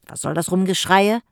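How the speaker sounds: loud, grumpy snort of a question; snorting annoyed. Acted. Jim annoyed at the visitors' noise, loud huff. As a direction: huff at the noise outside your door. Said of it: "schnaubte Jim".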